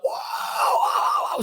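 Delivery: breathy yelled whoa of a startled man; startled commander yelling whoa; mock scream. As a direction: yelp as if struck from sleep. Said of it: "screaming at the top of his lungs".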